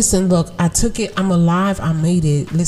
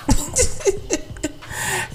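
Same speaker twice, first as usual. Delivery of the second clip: soft breathy laugh; 12 semitones higher, 5 dB quieter than usual. Real, not acted. Laughs at own remark.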